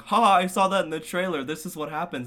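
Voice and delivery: put-on high, loud, fast know-it-all kid; mock kid's noisy commentary; loud child commenting. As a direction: blurt it out like an excited child. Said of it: "he was like".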